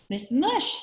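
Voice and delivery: high, loud sing-out; calling through door. A narrator's reading. Visitor announces herself through the door, high loud call.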